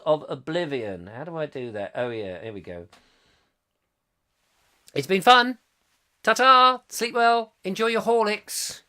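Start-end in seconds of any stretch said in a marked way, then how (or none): 4.9-8.8 cheery sign-off. Real, not acted.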